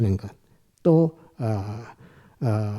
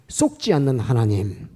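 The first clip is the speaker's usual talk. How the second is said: normal talking voice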